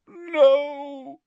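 high, loud groaned refusal; anguished moan, pitched high; groaning. A narrator's reading. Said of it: "he groaned".

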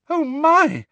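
very high, loud frightened squeal; high-pitched yelp of fright; terrified squeal. A narrator's reading. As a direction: squeal it in sudden terror. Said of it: "Harry heard Hermione squeal, terrified".

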